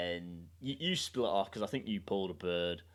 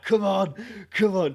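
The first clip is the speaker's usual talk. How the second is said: egging him on; 11 semitones higher, 11 dB louder than usual. Retold or imitated. Trying to big him up.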